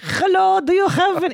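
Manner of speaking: high, loud mock Persian accent; Persian caller. Persian caller ringing the forklift business, mock Persian accent. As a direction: imitate a Persian customer on the phone. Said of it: "never do business with Persians".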